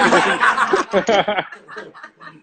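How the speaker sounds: long delighted laugh. Real, not acted. Delighted by the bar's texture.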